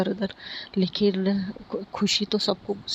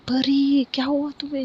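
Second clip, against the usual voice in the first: higher, louder startled call; frightened shout; 6 semitones higher, 4 dB louder than usual. A narrator's reading. Sister finds Pari fallen upstairs, alarmed shout. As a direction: cry out in alarm, raised in pitch. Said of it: "ज़ोर से चिल्लाती है".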